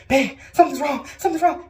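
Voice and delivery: high, loud acted alarm; urgent mock-panicked cry; retold panic. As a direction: re-enact panicking to your husband. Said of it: "Stop panicking".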